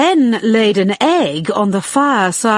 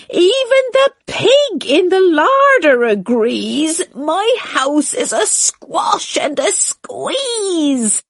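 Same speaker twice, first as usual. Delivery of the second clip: put-on higher, pleading little old woman; despairing old lady; 6 semitones higher than usual. A narrator's reading. Old lady begs the animals to stop, higher and despairing. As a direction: implore in despair. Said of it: "the little old lady cried".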